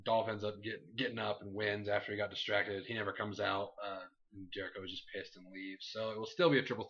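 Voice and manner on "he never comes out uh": ordinary talk, nothing marked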